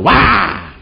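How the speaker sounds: loud, high shout of "Wah"; angry yell. Retold or imitated. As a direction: yell at the tree like the elders.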